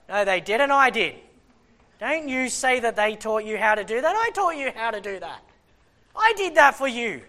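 loud, indignant acted scolding; jealous dad. Preacher as dad to his kids, jealous indignation. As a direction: claim the credit like an affronted father. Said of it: "and I'm jealous".

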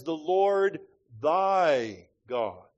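The Lord thy God, each word drawn out slowly; solemn, slow quote of "The Lord thy God"; slow quoted phrase. Real, not acted. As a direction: lean on "Lord" and stretch the whole phrase slowly. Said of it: "it says".

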